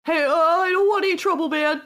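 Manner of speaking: high, loud, hurried protest; alarmed bystander. Acted. Bystander backs away from fight.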